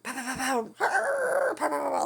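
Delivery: loud, high crowing pows; high-pitched rooster noise, building loud; imitated rooster alarm. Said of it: "and roosters. So it's like".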